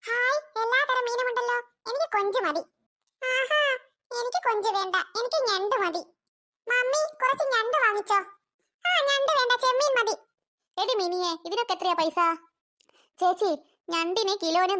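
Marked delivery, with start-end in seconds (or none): 0.0-10.2 put-on high child voice begging for seafood; a small kid tugging at mummy's sleeve; eager little kid. Kids asking mother for crab.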